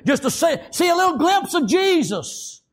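high, ringing preacher's cry; impassioned preaching. Acted. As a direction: lift it into a fervent pulpit call. Preaching builds to full force.

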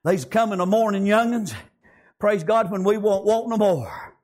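ordinary talk, nothing marked

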